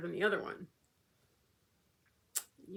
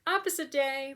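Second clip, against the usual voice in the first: the dog's cheeky reply, pitched higher; a playful made-up dog voice, higher than her own; 6 semitones higher, 4 dB louder than usual. Retold or imitated. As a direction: voice the stubborn little dog, high. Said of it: "little man read the text and was like".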